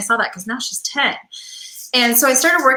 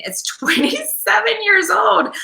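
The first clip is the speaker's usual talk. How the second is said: high, slow, laughing through the words; laughing in disbelief; 12 semitones higher, slower than usual. Real, not acted. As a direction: laugh out the age in amazement. Amazed cat still alive.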